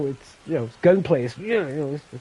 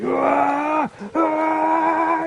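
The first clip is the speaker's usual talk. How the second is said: imitated scream; 14 semitones higher than usual. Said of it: "he starts to scream".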